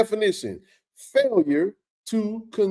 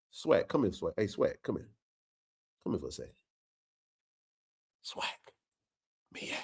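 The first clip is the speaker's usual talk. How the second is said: calling aside; 10 semitones lower, 13 dB quieter than usual. Real, not acted. Calling someone off-camera.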